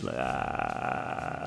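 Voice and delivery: low, soft drawn-out uh; mock an astronaut's tongue struggling to speak; long creaky drone; astronaut tongue noise. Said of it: "Is that part of the tongue moving around?".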